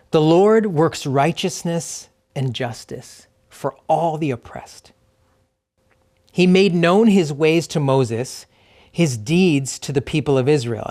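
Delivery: slow, measured reading with long pauses between phrases; slow scripture reading. Real, not acted. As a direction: read the psalm slowly, letting each phrase land. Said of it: "I'm gonna read it twice slowly".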